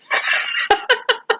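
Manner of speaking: breathless squeak of laughter; wheezy giggle; airy high giggle; high breathy laugh. Real, not acted. Whispery laugh after punchline.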